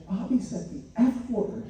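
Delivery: put-on high voice of an upset child, lightly done; tearful little girl. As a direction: imitate a small daughter confessing. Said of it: "she came to me crying".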